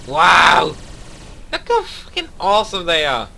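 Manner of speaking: loud high delighted exclaim; thrilled at the sight; excited wow. Real, not acted. Admiring new ships.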